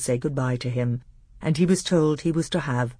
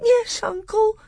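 put-on tiny, very high tearful child; cowed little boy answering through tears; squeaked, weepy submission; sobbing; 4 dB louder than usual. A narrator's reading. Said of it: "sobbed Caspian".

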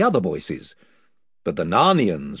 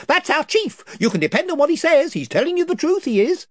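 higher eager followers; chorus of voices; 4 dB louder than usual. Acted. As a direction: cheer on your chief.

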